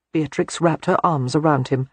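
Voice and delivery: ordinary delivery, nothing marked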